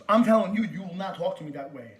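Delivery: loud, forceful warning; angry warning. Real, not acted. Caller warns store worker on phone, loud and angry. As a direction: come in hard and loud.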